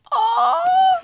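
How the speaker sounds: high, loud squeal of joy; happy squeal. Real, not acted. Vlogger looking at her stamps again, delighted squeal. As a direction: let out an overjoyed shriek. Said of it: "I'm so happy".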